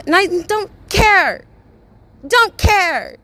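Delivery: high, loud, exasperated yell; furious yell of not caring; shouting. Real, not acted. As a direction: throw the words out, fed up. Shouting she doesn't care.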